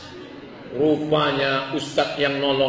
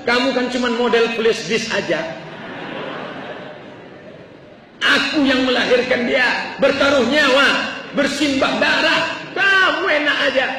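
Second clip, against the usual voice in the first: high, forceful imitation of a furious wife; istri ngamuk; 9 semitones higher, 4 dB louder than usual. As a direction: act out a wife's indignant tirade. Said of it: "Ngamuk istrinya". (menirukan istri mengamuk, suara tinggi)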